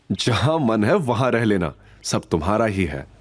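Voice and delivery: ordinary delivery, nothing marked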